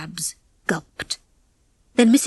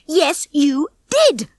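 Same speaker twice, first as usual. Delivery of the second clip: slow, high, emphatic shout; 7 semitones higher, much slower than usual. A narrator's reading. Ginger escalates the quarrel with Rocky, shouting. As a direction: stretch out each word in anger. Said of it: "Ginger shouted".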